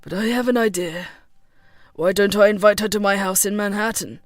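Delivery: lightly voiced low man, making a suggestion; thoughtful John. A narrator's reading. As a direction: offer the plan in a low male voice.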